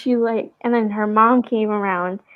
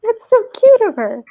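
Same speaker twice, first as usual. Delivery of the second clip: high, slow, gushing sweetness; cooing at the toddler who rubbed her leg, high and slow; gushing over a cute kid; 12 semitones higher, slower than usual. Retold or imitated. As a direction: coo it like you're melting over her. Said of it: "and I'm like".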